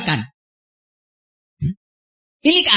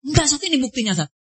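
higher, softer voice of a pupil objecting; imitated insistent protest; 4 semitones higher, 6 dB quieter, much faster than usual. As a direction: mimic someone eagerly pressing their proof. Voicing the insistent complainer.

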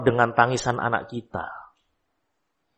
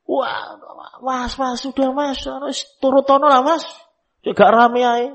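put-on wife nagging husband to give the child what he wants, higher pleading; 12 semitones higher than usual. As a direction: play the wife begging her husband to give in. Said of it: "ibu negara ini".

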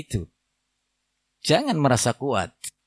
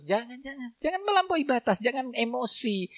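softer, higher, pleading calm; hushed, soothing warning; 8 semitones higher, 10 dB quieter than usual. Acted. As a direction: gently talk an angry man down. Preacher soothing imagined angry husband.

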